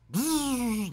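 high thin buzzing rocket whizz. Retold or imitated. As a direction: imitate a rocket flying past, high and soft. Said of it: "that's where the fucking rocket sound".

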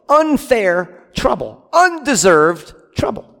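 Unfair drawn out slowly, then Undeserved; slow stressed repetition. Real, not acted. Preacher pressing the congregation, slow emphatic. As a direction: lean on each word, slow and weighty.

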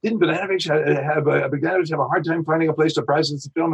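breathless eager fan talk, pitched high; gushing fanboy. Retold or imitated. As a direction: gush over the movie star like a wannabe. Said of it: "I made the mistake of every Hollywood wannabe".

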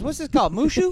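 mock Chris Tucker. Friend imitates Chris Tucker asking a question, high and loud. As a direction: squeak the question in a hammed-up voice. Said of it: "He goes".

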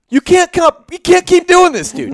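retold outburst, high and loud; exasperated telling-off. Homeowner ordering the intruder off his porch, exasperated re-enactment. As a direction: scold him at the end of your patience.

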